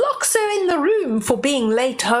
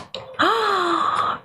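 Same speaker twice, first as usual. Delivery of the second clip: gasp; 7 semitones higher than usual. Acted. Story reader gasps at magic.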